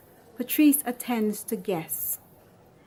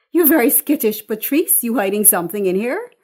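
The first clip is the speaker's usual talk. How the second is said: brash teasing mother; 6 dB louder, faster than usual. Acted. Mother barging in on her daughter, loud and teasing.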